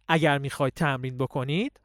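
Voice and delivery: ordinary delivery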